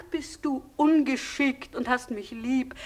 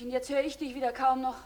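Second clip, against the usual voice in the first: ordinary delivery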